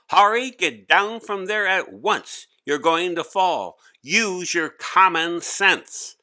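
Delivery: teacher commanding Hari to get down at once; stern command. Acted. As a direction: order him down with authority. Teacher orders boy down.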